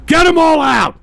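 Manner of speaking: high, very loud bellow; furious shout. Real, not acted. Streamer ordering all chatters banned, furious shout. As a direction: yell the order in real fury.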